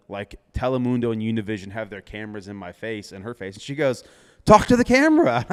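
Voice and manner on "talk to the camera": loud high urging; hyped push toward the camera; urging him on, much louder and higher; excited. Retold or imitated. Quoting her urging him on.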